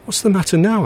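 irritated. A narrator's reading. Narrator irritated at Richard lingering on the bench, exasperated. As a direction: snap the question, fed up. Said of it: "I began to feel irritated".

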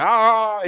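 high, drawn-out "ah" of someone lost for words; mock stumped "ahhh"; imitated stumped noise. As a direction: mimic a speechless man's stalling sound. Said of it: "didn't know what to say".

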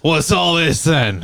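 slow, cheesy English bobby voice; horrendous fake English accent, slow; bad English accent. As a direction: ham up a British policeman, slowly. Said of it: "This is horrendous".

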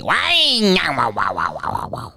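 imitated DJ wah-wow sound effect; vocal sound effect trailing off; radio DJ sound effect. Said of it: "That's his sound effect that he uses".

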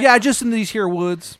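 loud, high, exaggerated Maryland twang; terrible Maryland accent. Baba Yaga greeting a newcomer, bad Maryland accent. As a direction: holler in a hammed-up Maryland accent. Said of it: "She's got the shittiest Maryland accent".

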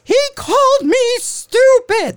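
high, whining imitation of an upset man; mocking a commenter's high whine; whiny mock voice. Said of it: "He's like".

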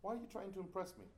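high imitation of a demanding teacher, loud at first; retold scolding questions; mock interrogation. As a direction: grill the student, easing off toward the end. Retold teacher grilling the writer.

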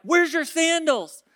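high, loud, incredulous question; retold startled question. Asking companion about missing sandals, startled retold question. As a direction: burst out with it, baffled.

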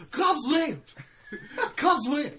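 high, loud victory cheer; triumphant announcer call. Acted. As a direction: shout it like a winning call.

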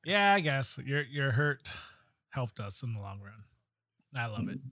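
usual speaking voice

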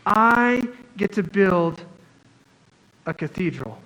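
slow, savoring each word with a smile; a worker proudly marveling at his calling; happy and awed. Retold or imitated. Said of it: "he's smiling, he's happy, he goes".

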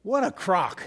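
a short, scornful dismissal; blunt scoff. Real, not acted. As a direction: scoff at the flattery. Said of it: "Can I just be blunt?".

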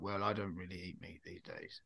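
low, very soft nan voice; imitating nan. Nan turning down meat, imitated nan. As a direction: lightly play a nan, dropped low and quiet. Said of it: "And she was like".

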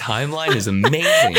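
ordinary delivery

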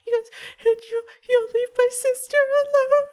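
put-on very high, halting little-kid voice; tearful child. Child asking stranger to spare the sister, tearful child voice. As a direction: plead like a small frightened child. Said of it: "he's now bawling inconsolably".

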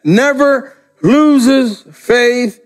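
slow emphasis. Shown as a demonstration. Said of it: "Listen to that".

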